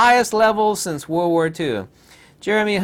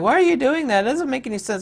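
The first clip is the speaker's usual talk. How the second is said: mock confused people; 10 semitones higher, faster than usual. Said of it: "People are like".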